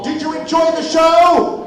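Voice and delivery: high, loud call to the crowd; rousing question to the audience. Real, not acted. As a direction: shout it out to the whole audience. Calling out to the audience.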